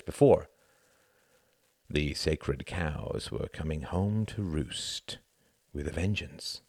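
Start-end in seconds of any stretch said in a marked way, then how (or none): none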